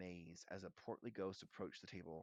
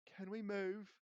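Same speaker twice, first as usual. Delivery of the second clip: higher, louder, disgusted plea; grossed-out schoolboy voice; disgusted boy; 10 semitones higher, 7 dB louder, slower than usual. A narrator's reading. Ron disgusted by food.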